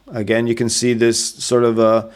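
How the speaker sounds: usual speaking voice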